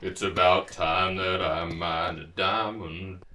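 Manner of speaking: slow low relief. Real, not acted. Finally mining a diamond.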